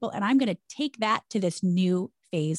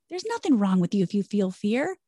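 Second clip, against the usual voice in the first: ordinary talk, nothing marked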